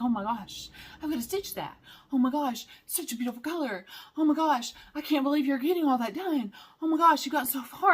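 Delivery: breathless fangirl gushing; gushing praise. Retold or imitated. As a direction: pile on the compliments in delight. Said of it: "They're like".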